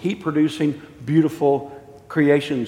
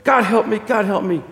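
high cry for help; acted anguished prayer; desperate plea; 7 semitones higher than usual. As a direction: cry out in torment, easing slightly on the repeat. Ghost in torment prays for help.

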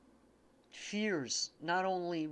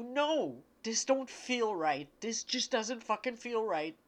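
emphatic, higher-pitched reassurance; urgent, emotional plea in a raised voice; impassioned channeling; 4 semitones higher, 4 dB louder than usual. Real, not acted. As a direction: insist on it with overwhelmed conviction. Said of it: "I gotta go with this".

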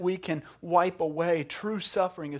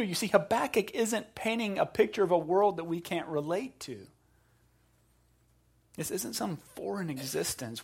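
ordinary talk, nothing marked

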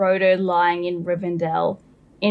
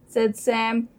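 usual speaking voice

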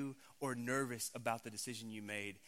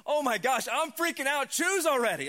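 much higher and louder, hammed-up panic; mock freaking-out God; 11 semitones higher, 13 dB louder than usual. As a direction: squeal it like an impatient fan on the edge of the seat. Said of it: "sitting on the edge, like, going".

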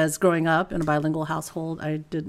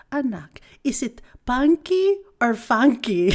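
higher-pitched Filipino mother impression; imitating mom's Filipino accent; 5 semitones higher than usual. Mom asking her kids about a show, imitated Filipino accent. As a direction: ask it as a confused Filipino mom would. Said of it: "the P's and the F's get all confused".

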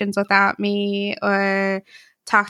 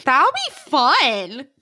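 sarcastic; 4 semitones higher, slower than usual. Real, not acted. Teased about being left out.

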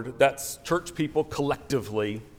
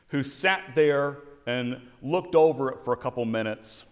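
ordinary talk

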